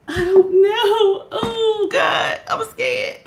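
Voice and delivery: high, hushed, laugh-crying fright; half-sob, half-giggle, barely voiced; scared. Real, not acted. Feet off the floor, she frets high and hushed.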